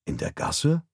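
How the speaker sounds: surprised question, rising high; high, rising query of surprise; astonished. A narrator's reading. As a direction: echo it in astonishment. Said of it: "fragte ich erstaunt".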